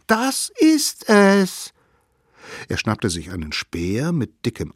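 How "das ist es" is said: put-on high, slow, loud child's voice; drawn out with delight; little one exclaiming. A narrator's reading. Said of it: "rief der Allerkleinste".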